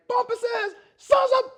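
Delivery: very high, loud furious cussing cut by laughter; hammed-up tirade at the dogs; old man cussing. Old man cussing out the neighbor's dogs, shrill mock rage. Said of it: "He was like".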